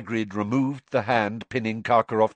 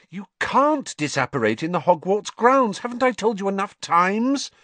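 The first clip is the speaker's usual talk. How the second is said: high-pitched exasperated scolding; 11 semitones higher, 3 dB louder than usual. A narrator's reading. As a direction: correct a friend who never listens. Exasperated Hermione correcting her friend.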